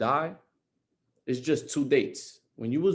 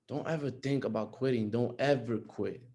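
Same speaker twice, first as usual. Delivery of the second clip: holding back emotion; 4 semitones lower, 9 dB quieter than usual. Real, not acted. Host urging listeners to keep going, softly emotional. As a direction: let the words sink in, quietly moved. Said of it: "I'm not gonna get emotional".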